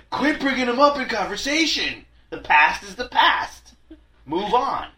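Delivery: high, loud hammed-up lecture through laughter; slow, laughing fake reprimand; mock scolding. Teasing co-host about jealousy.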